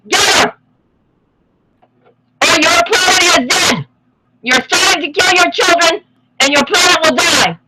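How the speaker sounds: high, loud urgent rant; shouting. Real, not acted. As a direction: shout the warning. Ranting call to wake up.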